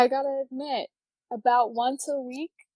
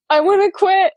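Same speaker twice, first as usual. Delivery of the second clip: louder and higher mock whine; whiny quitting wail; 9 semitones higher, 9 dB louder than usual. Telling her friend she wants to quit, mock loud whine. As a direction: wail it like you're giving up. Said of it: "I'm like".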